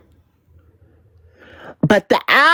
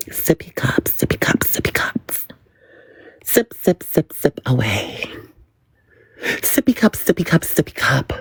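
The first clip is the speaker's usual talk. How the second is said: whispered taunt; 5 dB quieter, faster than usual. Acted. Taunting chant at a rival.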